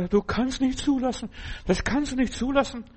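high, pleading cry to God; desperate plea. Acted. Prophet pleading with God at the wall, high desperate cry. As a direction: beg God not to allow it, voice up high.

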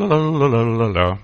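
slow lalala babble; babbling la-la. Shown as a demonstration. Preacher demonstrating babbling prayer, slow la-la. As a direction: let the syllables roll out like a baby. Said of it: "dann fang an zu lallen".

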